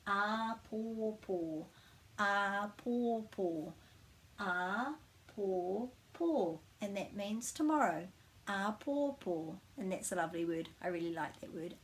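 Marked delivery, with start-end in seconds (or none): 0.1-6.6 a pō pō drawn out slowly, every syllable equally weighted; even stress. Shown as a demonstration. Teacher modelling a pō pō with even stress for learners. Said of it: "each syllable is actually stressed the same". 8.5-9.6 slow a pō pō with equal syllables; even stress. Shown as a demonstration. Teacher repeating a pō pō slowly. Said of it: "each syllable is actually stressed the same".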